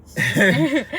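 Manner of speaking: loud high laugh; burst of laughter. Real, not acted. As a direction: crack up for real. Laughing at the joke.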